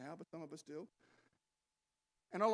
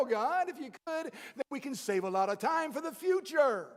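louder, pushy, insistent pleading; impatient demand; 4 semitones higher, 8 dB louder than usual. Pretend. Preacher voicing a congregation demanding things from God now.

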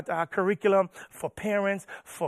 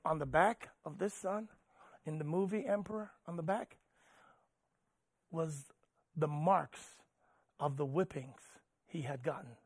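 soft, solemn, with long pauses; hushed sermon storytelling; 6 dB quieter than usual. Real, not acted. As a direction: let each grim detail land quietly.

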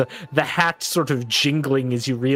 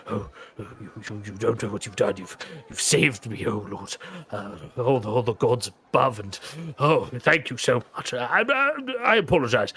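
relieved fussy servant. Acted. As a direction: gush with relief as a nervous servant. NPC Reynold thanks in relief.

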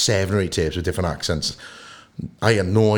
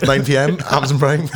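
Welsh thespian accent with American touches; louder, higher showy Welsh-American announcement; Richard Burton; 6 semitones higher, 5 dB louder than usual. Shown as a demonstration. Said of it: "my take on a Richard Burton".

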